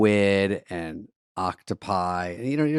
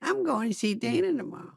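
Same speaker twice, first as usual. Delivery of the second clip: high, soft, airy boast; smug manager; 12 semitones higher, 7 dB quieter than usual. Retold or imitated. Manager bragging between shows, high and soft. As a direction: brag in a thin, dainty voice. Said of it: "he's like this".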